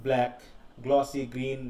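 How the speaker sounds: normal talking voice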